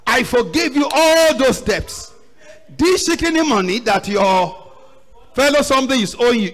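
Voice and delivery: angry rebuke. Acted. Master rebukes the wicked servant, angry rebuke.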